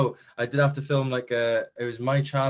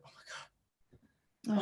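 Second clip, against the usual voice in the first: slow, hushed, drawn-out "oh my God"; overwhelmed; 10 semitones higher, 8 dB quieter, much slower than usual. Retold or imitated. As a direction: let it out slowly and softly, moved. Overwhelmed by parents' pride.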